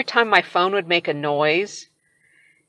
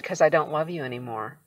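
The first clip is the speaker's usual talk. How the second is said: softer, totally cold and flat-out dead; 3 semitones lower, 7 dB quieter than usual. Retold or imitated. Wife telling husband she no longer loves him, cold and dead. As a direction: say it with no feeling at all. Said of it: "I just said it just like that, like, totally cold and dead".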